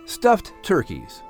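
usual speaking voice

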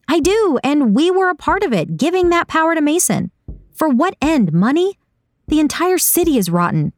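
high-pitched, agitated tirade; impassioned high character voice without shouting; heated outburst. A narrator's reading. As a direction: let it all out, pitch held high. Saga admits despising them, upset.

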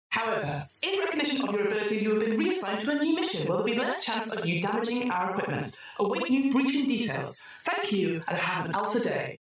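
high, bright AI announcer; the computer rewarding the troubleshooters, in a chirpy synthetic voice; cheery computer voice. Acted. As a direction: sing out the congratulations like a cheerful machine.

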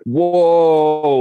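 high, loud drawn-out whoa; amazed whoa. Retold or imitated. Husband hearing the real reveal is coming, high loud amazed whoa. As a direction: let the surprise burst out. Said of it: "And I was like".

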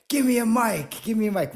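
loud, high demanding call; mock yell. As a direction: shout for the mic, hammed up. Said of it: "I was like".

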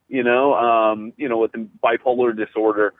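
ordinary talk, nothing marked

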